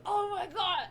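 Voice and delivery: high, loud exclamation; mock shock. Dodger cries out as he slingshots the baddie, high and loud. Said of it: "and he go".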